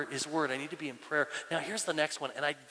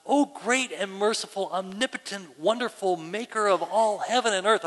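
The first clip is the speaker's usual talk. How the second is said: mock lofty, ornate praying with raised pitch; grandiose prayer; 4 semitones higher, 6 dB louder than usual. Person attempting a first prayer aloud, mock grandiose. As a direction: pray like someone putting on formal church talk. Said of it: "you talk like that normally".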